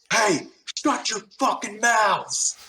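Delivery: high, slow mock bark at the chatty squad; high drawn-out mock snarl; mimicked angry snap. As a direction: imitate a rude gunner snapping. Machine gunner snaps at squad.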